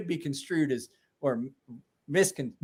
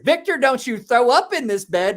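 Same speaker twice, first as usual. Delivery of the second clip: retold yell; 6 semitones higher, 6 dB louder than usual. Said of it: "Susie's yelling in my ear".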